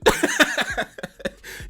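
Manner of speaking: very high squeal of laughter. Real, not acted. Vlogger laughing at the quote, high squeal. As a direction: squeal with delight.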